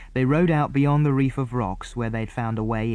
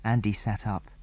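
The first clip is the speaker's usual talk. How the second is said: ordinary delivery, nothing marked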